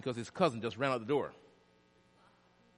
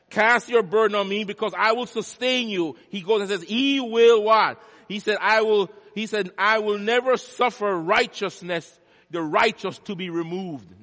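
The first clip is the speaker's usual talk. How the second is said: full-force preaching; 8 semitones higher, 10 dB louder than usual. Real, not acted. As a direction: thunder God's promise out to the congregation.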